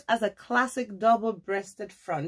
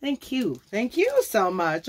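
ordinary talk

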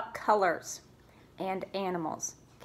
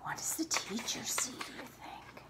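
hushed, curious whisper; secretive storytime whisper; 15 dB quieter, faster than usual. Acted. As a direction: lean in and whisper the question. Whispered question to children.

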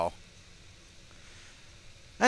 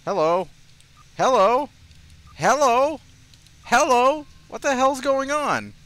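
higher and louder each time; calling out, more baffled each time; rising disbelief; 12 semitones higher, 6 dB louder than usual. Real, not acted. Baffled at an unresponsive game.

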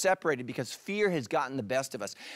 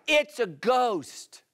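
drawn-out cry; 7 semitones higher, much slower than usual. Retold or imitated. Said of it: "they cried out".

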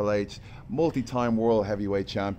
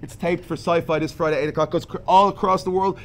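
loud, higher-pitched event announcement; show promo; 7 semitones higher, 4 dB louder than usual. Pretend. Wrestler selling tonight's show to listeners, promo delivery. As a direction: hype the crowd for tonight, then ease down.

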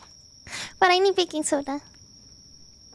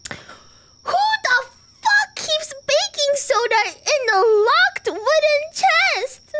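high, slow, shouted outrage; fed-up gamer wailing at a puzzle; exasperated yell; 11 semitones higher, much slower than usual. Real, not acted. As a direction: drag it out in crying disbelief. Outraged at baking soda.

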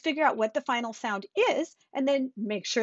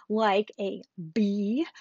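slow, deliberate teaching; much slower than usual. Shown as a demonstration. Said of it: "a final consonant means".

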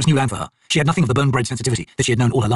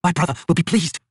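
bitter; 7 semitones higher than usual. A narrator's reading. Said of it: "Bitterness filled Kilava's voice".

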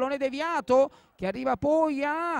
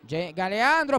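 ordinary delivery

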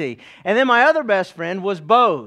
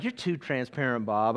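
softer, lower aside; imagined listener's objection; 8 semitones lower, 9 dB quieter than usual. Retold or imitated. Congregant chiding the preacher for oversharing, hushed mock complaint. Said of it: "He's gonna be like".

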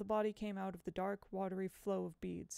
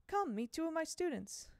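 gentle high teacher voice; 9 semitones higher than usual. Acted. Miss Foley introduces boys.

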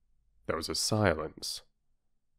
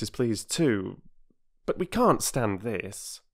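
put-on raised, awkward boy's protest; boy embarrassed by too much praise; 7 semitones higher than usual. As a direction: politely ask her to stop praising. Peter embarrassed.

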